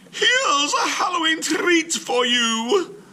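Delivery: very high, slow, loud sing-song; sweet old lady. Acted. Missus Wilkins offers Mister Klug a treat, high and sing-song. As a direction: present it like a delighted granny.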